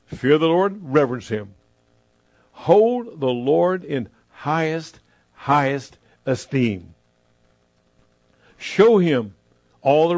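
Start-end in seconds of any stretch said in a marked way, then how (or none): 2.5-7.0 slow, drawn out. Acted. Preacher dwelling on reverence.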